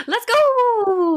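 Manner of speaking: high, loud hype cheer; excited shout. Pretend.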